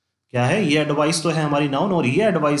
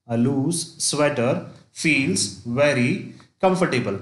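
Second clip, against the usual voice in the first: slow dictation; much slower than usual. Shown as a demonstration. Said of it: "dictating an example sentence slowly".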